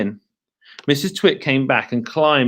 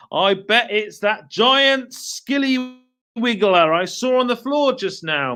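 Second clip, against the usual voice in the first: sly husband; 8 semitones higher than usual. Acted. Mr Twit teasing voice.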